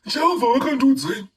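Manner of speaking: high, loud, whining mock prophecy; mocking the self-proclaimed prophet. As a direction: parody the false apostle proclaiming God's word. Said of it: "this person comes and tells you".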